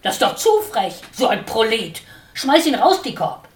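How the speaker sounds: loud, high angry cry; outraged shouting. A narrator's reading. Watscheline screams at Dickhop to throw the sparrow out. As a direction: scream for him to act. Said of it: "schrie Watscheline".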